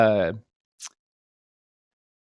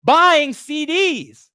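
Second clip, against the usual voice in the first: high, loud and slow, in disbelief; can't believe anyone buys CDs; incredulous; 12 semitones higher, 6 dB louder, much slower than usual. Real, not acted. Podcaster baffled that people still buy CDs, incredulous.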